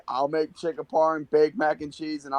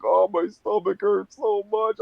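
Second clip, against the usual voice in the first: a hint of a higher, whiny complaint; mock whining; 6 semitones higher than usual. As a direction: whine it lightly, like a kid with a bellyache. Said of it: "he's, like, whining".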